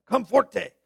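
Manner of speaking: relished Latin-style accent on comforte; foreign-accented "comforte". Shown as a demonstration. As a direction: say "comfor-TAY" with an accented flourish. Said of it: "I like that accent".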